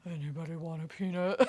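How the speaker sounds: low, soft mock-giant voice; badly faked deep voice; deep diaphragm voice. Shown as a demonstration. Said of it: "have to say it with my diaphragm".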